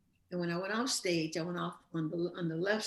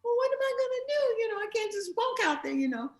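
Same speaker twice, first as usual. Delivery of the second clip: much higher-pitched flustered self-quote; mock panic; 4 dB louder than usual. Stuck backstage before her next entrance, retold mild panic. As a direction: lightly act out being stuck and at a loss.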